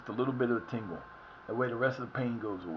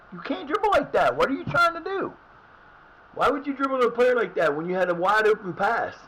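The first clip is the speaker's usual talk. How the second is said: high, loud exasperated yelling at the screen; frustrated yelling; 8 semitones higher, 6 dB louder than usual. Real, not acted. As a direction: yell at your dumb players. Yelling at players in game.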